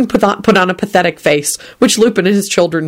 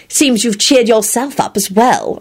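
snappish; 5 semitones higher, slower than usual. A narrator's reading. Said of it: "Elizabeth replied snappishly".

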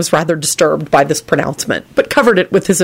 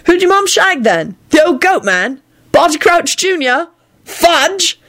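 high, lively teasing figure voice; cheeky George; 12 semitones higher, slower than usual. A narrator's reading. George guessing at the secret father, cheeky.

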